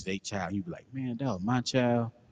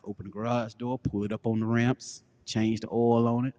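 usual speaking voice

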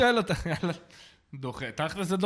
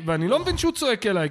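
normal talking voice

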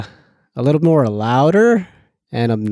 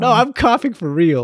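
pitched-up mock protest; high protest; 8 semitones higher than usual. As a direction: whine that you're really sick. Insisting he's sick.

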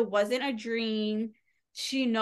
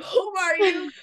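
high, louder startled question; 9 semitones higher, 5 dB louder than usual. Retold or imitated. Heroine to a man who walks in, startled. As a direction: act out alarm at a stranger, pitched up.

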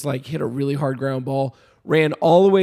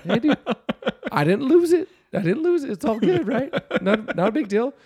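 high, laughing insistence that all is fine; chuckling I'm fine, buddy; laughing reassurance; 7 semitones higher than usual. Retold or imitated. Retelling reassuring his friend.

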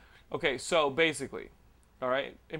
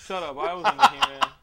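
high laughing protest; giggling denial; 12 semitones higher, 7 dB louder than usual. Real, not acted. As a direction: laugh it out, pitched way up.

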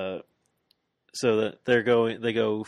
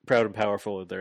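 ordinary delivery

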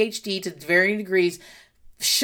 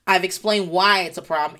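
normal talking voice

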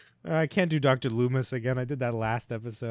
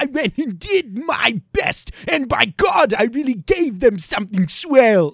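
much higher, shouting, slow and showy; Jack Skellington; 10 semitones higher, 9 dB louder, slower than usual. Retold or imitated. Mocking Jack Skellington boasting, high and shouted. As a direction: proclaim it like a proud pumpkin king. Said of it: "that's his fucking nasty ass".

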